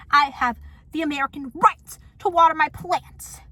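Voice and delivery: loud, emphatic protest; indignant retort. Acted. Vlogger arguing back at the neighbor's complaint, indignant. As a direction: declare it like a wronged citizen.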